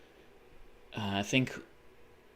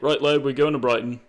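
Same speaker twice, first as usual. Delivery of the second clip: put-on loud, higher working-class English accent; mock English accent; 3 semitones higher, 9 dB louder than usual. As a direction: play a cheerful English dad, English accent. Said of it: "everyone was like".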